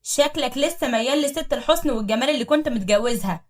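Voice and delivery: bang the table and shout it; loud accusation. Acted. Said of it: "وقالت بصوت عالي". (صياح غيرة عالٍ)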